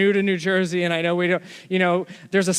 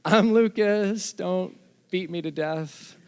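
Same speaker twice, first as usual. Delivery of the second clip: timid introduction; 3 semitones higher, slower than usual. Retold or imitated. Preacher re-enacts introducing himself to intimidating biker, mock-timid. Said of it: "summon up my courage".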